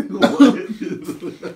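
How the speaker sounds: laughing through the words. Real, not acted. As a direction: laugh it out in disbelief. Laughing disbelief at candy taste.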